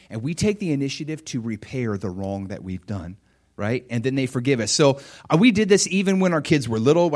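ordinary talk

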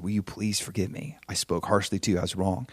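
low, hushed plea for forgiveness; humble apology. Retold or imitated. Husband apologizing to his wife after harsh words, humbly and quietly. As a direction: softly own the fault, contrite. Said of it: "I humbled myself".

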